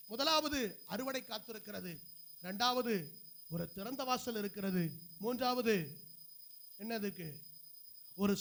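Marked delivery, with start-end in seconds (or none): none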